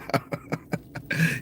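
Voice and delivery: breathy high laugh. Real, not acted. Laughing at the grandfather skit he's retelling. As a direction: burst into laughter at the joke.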